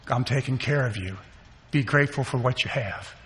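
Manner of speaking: quiet, gentle self-talk; hushed reassurance to himself; soft inner voice. Retold or imitated. Inner voice quietly reassuring him.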